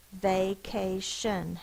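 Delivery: "Vacation" slowly split into three beats; syllable by syllable. Shown as a demonstration. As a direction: say "Vacation" as va, ca, shun. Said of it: "How many syllables are in that?".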